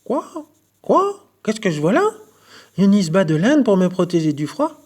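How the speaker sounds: high, loud croaky frog voice; surprised frog croaking. A narrator's reading. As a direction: squeak it out like an astonished little frog.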